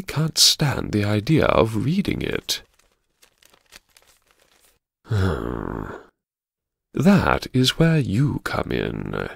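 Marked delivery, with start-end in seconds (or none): 5.1-6.0 long, low exhaled sigh; heavy sigh. Acted. Diarist who dreads reading it, a heavy sigh. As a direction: let out a slow, reluctant sigh. Weary sigh before facing the task.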